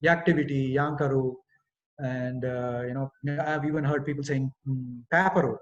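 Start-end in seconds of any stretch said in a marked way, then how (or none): 0.0-1.3 "yaktivity, yankaru" with an added 'ya' at the start; common mispronunciation. Retold or imitated. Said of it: "I've seen saying". 5.1-5.6 PAPER said as "paaparu" with an extra final 'u'; common mispronunciation. Retold or imitated. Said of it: "for paper".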